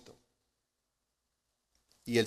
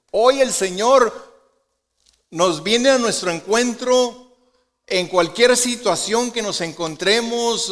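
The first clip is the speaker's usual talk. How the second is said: preaching at full force; 7 semitones higher, 8 dB louder than usual. Real, not acted. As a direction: lift the voice and proclaim it. (predicando con fuerza, alto y lento)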